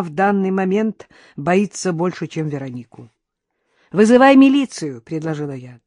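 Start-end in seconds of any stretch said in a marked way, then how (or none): 3.9-5.0 raised, louder voice; urgent suggestion. A narrator's reading. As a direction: urge it loudly and higher. Urging a call for police. (настойчиво, громко)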